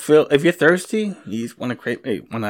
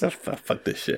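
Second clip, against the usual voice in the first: muttered curse; 9 dB quieter than usual. Real, not acted. Grumpy dieter mutters to himself.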